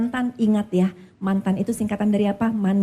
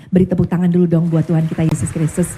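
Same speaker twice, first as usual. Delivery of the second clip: louder and fast, calling out to the congregation; rousing call; 3 semitones lower, 7 dB louder, faster than usual. Real, not acted. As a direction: get the room clapping for Jesus. Preacher calls for applause. (pendeta berseru lantang mengajak jemaat bertepuk tangan)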